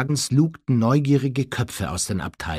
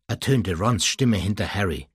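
ordinary delivery, nothing marked

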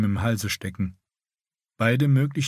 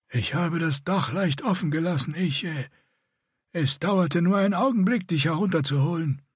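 higher, grating voice like a rasp; rasping scarred warrior; 8 semitones higher than usual. A narrator's reading. Scarred Bretan rebuffing the older man, higher rasping voice. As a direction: scrape each word out like a rasp. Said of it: "krächzte er".

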